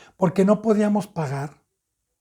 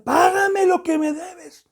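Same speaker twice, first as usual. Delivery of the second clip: demanding; 11 semitones higher, slower than usual. A narrator's reading. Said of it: "la ahogaba y decía".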